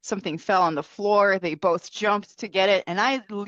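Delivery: usual speaking voice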